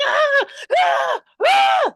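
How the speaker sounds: screaming. Retold or imitated. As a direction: scream in panic at the battle, climbing up. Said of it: "I'm, like, screaming".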